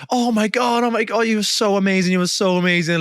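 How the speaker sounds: high, gushing over-the-top praise. Retold or imitated. Mocking co-stars gushing over each other. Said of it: "over the top like that".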